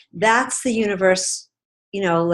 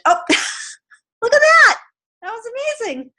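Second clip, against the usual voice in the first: delighted surprise; 5 dB louder, faster than usual. Real, not acted. Reacts with delight on video call.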